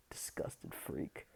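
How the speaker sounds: slow, hushed whisper of contempt; whispered aside to herself, disgusted; whispered insult. Retold or imitated. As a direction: hiss it under your breath slowly. Muttering insult under her breath.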